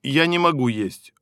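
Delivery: ordinary talk, nothing marked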